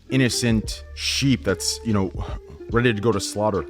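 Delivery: ordinary talk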